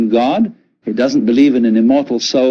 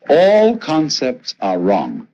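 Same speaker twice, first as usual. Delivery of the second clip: ordinary delivery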